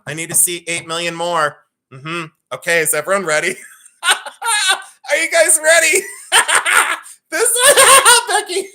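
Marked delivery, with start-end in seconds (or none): 4.0-8.7 real laughter, high and squealing; can barely get the words out for laughing; laughing hard. Real, not acted. Host laughing at her own baby photo while talking to viewers.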